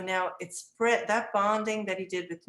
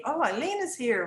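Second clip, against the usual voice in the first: higher-pitched imitation of an excited child; child calling out; 7 semitones higher, 3 dB louder than usual. Boy greets teacher as she enters, imitated excited calling. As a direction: call it out like a little boy. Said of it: "he kept calling my name".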